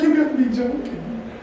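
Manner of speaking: high rising incredulous line; high-pitched you gotta be joking; amazed disbelief. Retold or imitated. Retold disbelief at minks.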